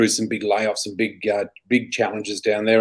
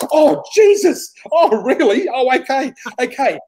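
flustered shock; 12 semitones higher, 3 dB louder than usual. Retold or imitated. Man reacts to her hairy-chest request, flustered. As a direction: imitate someone thrown, then scrambling to accept.

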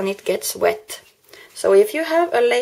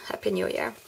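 murmured card text; whispered reading; 8 dB quieter, faster than usual. Real, not acted. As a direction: softly whisper the card's greeting. Reading card greeting softly.

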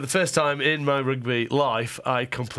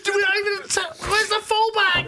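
retold furious yell. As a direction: yell at your own team in frustration. Continues retold rant at teammates.

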